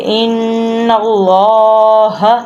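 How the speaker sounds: slow "ان اللہ", nasal held n, thick long l-aa; ghunna and heavy lam. Shown as a demonstration. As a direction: hum the n of "ان", then thicken the l of "اللہ". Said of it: "لام کو موٹا بھی کریں گے". ("ان" پر غنہ اور "اللہ" کا لام موٹا)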